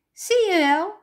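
high, loud triumphant cry; loud I-told-you-so, pitched high; children shouting. A narrator's reading. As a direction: shout it like gleeful kids. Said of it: "schreeuwden de stampertjes".

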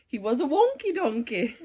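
plain reading voice

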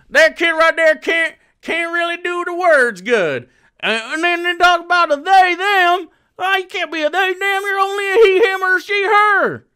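loud, high-pitched Southern rant; mock a Southern man, shouting way up high; hammed-up Southern yokel holler.

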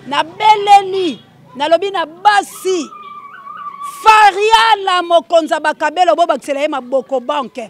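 high, loud, stretched-out call; crying out praise. Real, not acted. Host acclaiming Fariala, crying out praise. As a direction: proclaim the name at full voice.

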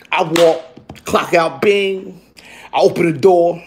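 ordinary delivery

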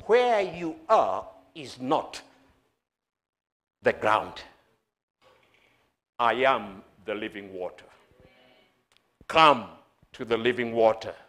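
low, measured words with long pauses; calm. Acted. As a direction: say it gently and unhurried, as Jesus. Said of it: "Jesus would just calmly say".

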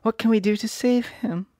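put-on high little girl voice; worried girl asking. A narrator's reading. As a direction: plead as young Dorothy. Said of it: "asked Dorothy".

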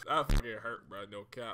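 normal talking voice

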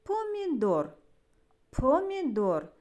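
"Помідор" said carefully with an exaggerated first P; emphasized P sound. Shown as a demonstration. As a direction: make the opening П in "Помідор" stand out clearly. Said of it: "щоб добре було чути звук П".

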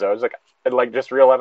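ordinary talk, nothing marked

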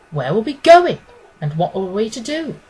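ordinary delivery, nothing marked

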